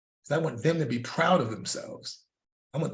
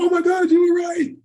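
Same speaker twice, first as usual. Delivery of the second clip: much higher and louder, astonished cry; amazed shout; 9 dB louder than usual. Retold or imitated. Client realizing her trainer was right, shouting in amazement. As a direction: blurt it out in total amazement. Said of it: "She was like".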